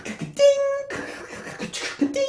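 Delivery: rattling key clicks with a high ding; typewriter imitation. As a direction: clatter away, then ring the bell.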